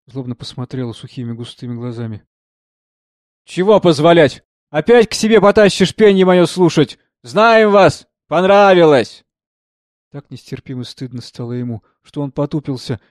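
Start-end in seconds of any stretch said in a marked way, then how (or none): 3.4-9.2 loud, high, accusing jeer; angry rebuff. A narrator's reading. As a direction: turn on him with bitter scorn. Singer angrily rebuffs the officer. (зло и громко отчитывая)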